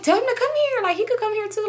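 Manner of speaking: high-pitched imitation of a girl inviting; eager friend. Friend inviting her boyfriend over, high-voiced imitation. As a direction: pitch it way up, breezily insistent.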